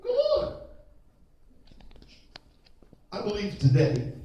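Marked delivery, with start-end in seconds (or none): 0.0-0.6 light, very high, casual come on; relaxed Jesus beckoning; easy invitation. Shown as a demonstration. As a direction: invite him simply and gently. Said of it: "Jesus talked like this".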